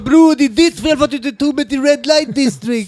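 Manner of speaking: high, whining blame; whiny roommate. Retold or imitated. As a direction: whine like a sulking kid. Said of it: "Han bara".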